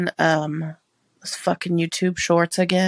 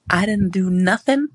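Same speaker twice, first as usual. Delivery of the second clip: ordinary talk, nothing marked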